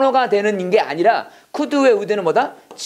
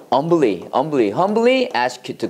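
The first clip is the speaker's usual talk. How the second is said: lower, slow, repeated word; slow model phrase; 5 semitones lower, much slower than usual. Shown as a demonstration. Teacher drilling a humble prayer phrase, slow. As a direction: drill it slowly. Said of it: "겸손하게 기도합니다는".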